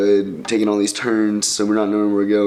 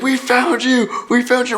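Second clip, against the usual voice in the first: gleeful cheer; 13 semitones higher than usual. Retold or imitated. Friends in truck cheer.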